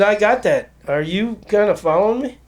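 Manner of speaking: mock exasperation at being followed; exasperated. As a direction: push back at the persistent fan.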